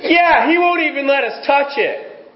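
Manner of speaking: high mock-whiny Eve; Eve's grumbling voice. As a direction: play Eve sulking that he won't let them. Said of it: "in other words, she says".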